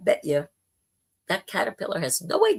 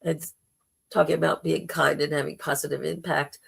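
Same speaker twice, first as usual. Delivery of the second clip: ordinary talk, nothing marked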